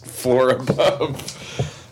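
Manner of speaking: normal talking voice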